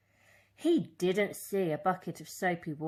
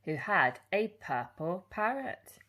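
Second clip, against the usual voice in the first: ordinary delivery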